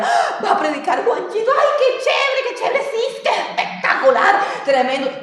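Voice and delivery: high-pitched mock squeal of excitement; gushing fan. Congregant thrilled that Juanchito will preach, mock gushing.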